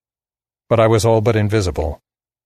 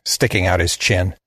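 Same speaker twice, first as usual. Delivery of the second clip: ordinary delivery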